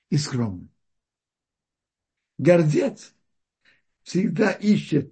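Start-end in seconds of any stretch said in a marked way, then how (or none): none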